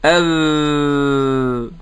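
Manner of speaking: "أذ-" held long with a buzzing voiced ذ. Shown as a demonstration. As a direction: stretch the ذ in "أذ-" so the throat vibrates. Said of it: "وقلنا".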